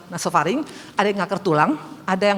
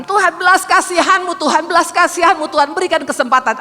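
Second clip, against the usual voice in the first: higher, louder cry for mercy; pleading prayer; 7 semitones higher, 5 dB louder than usual. Retold or imitated. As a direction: beg God to spare him. Retold plea for husband's life. (doa memohon belas kasihan dengan suara tinggi)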